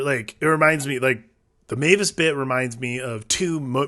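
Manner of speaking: ordinary talk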